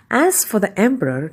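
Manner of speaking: usual speaking voice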